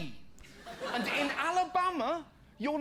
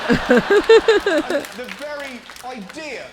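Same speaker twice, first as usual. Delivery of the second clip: hammed-up indignant denial; 9 dB louder than usual. Acted liar's overblown denial.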